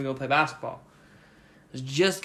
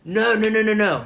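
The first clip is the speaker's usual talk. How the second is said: louder, higher correcting himself; emphatic no; 7 semitones higher, 7 dB louder than usual. Real, not acted. Storyteller cuts himself off to correct the story, loudly. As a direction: stop himself, insisting.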